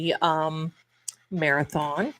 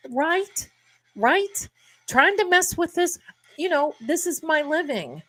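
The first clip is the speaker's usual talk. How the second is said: indignant; 8 semitones higher than usual. Real, not acted. Indignant about people messing with her.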